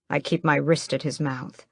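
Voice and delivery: ordinary delivery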